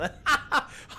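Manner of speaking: high unvoiced cackle; high cackling laugh. Merchant woman cracking up, high cackle. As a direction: cackle like a witch. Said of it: "she is just fucking laughing".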